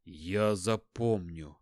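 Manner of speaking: low, slow tearful promise; sobbing. Acted. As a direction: say it through sobs, slowly. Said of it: "всхлипывая, сказал Марун". (сквозь всхлипы, тихо и медленно)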